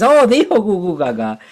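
hammed-up parental excitement; thrilled parent. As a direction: gush like a proud parent. Delighted parent quoting baby.

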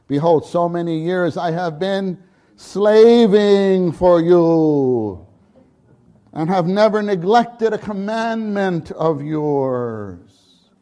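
loud, slow grievance; older son complaining to father; resentful complaint. Acted. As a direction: drag it out bitterly.

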